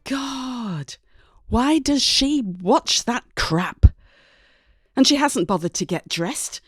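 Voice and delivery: plain reading voice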